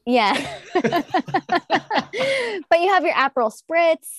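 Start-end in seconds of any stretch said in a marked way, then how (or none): 0.4-2.6 a long, high burst of laughter; real laugh. Real, not acted. Guest laughing at the dumpster breeze joke, real laugh.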